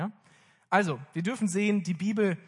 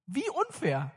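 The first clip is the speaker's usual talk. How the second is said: much higher, mock-whining as the slighted younger brother; whiny complaint; 9 semitones higher, slower than usual. Preacher voicing Abel's hurt to the church, high mock-whine. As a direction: pitch it up like a sulking kid.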